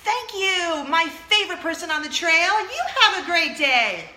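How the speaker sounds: over-the-top high excited thanks; gushing thanks. Retold or imitated. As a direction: overflow with gratitude to a stranger. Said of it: "I said".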